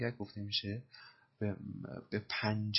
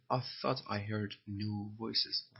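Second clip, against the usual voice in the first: slow dictation; much slower than usual. Shown as a demonstration. Teacher repeats film line slowly.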